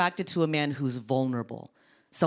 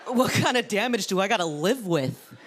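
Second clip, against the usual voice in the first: appalled house-hunter reaction; 5 semitones higher than usual. Acted. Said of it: "and be like".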